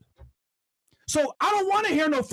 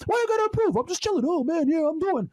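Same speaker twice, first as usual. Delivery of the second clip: much higher, lazy mocking voice; mock lazy slacker; 4 semitones higher than usual. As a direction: ham up a slacker brushing it off.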